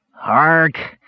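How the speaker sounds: high growl of exasperation; high, exasperated outburst; frustrated cry. A narrator's reading. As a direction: burst out in frustration. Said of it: "he finally cried in frustration".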